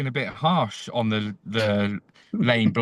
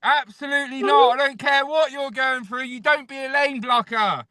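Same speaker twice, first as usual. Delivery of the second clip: hammed-up fury, much higher; furious mock shout; 12 semitones higher than usual. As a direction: bark the refusal at lane blockers. Angry reversal, shouting.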